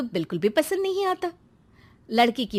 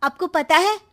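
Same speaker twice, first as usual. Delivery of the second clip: scheming tattling; 5 semitones higher than usual. Acted. Daughter telling mother about brother's married love, tattling. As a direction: stretch out the scandal for Mom.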